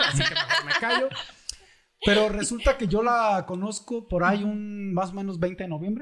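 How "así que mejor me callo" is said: high, laughing aside; a chuckling self-censor. Real, not acted. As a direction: laugh it off as you hold back. Joking he'd rather stay quiet.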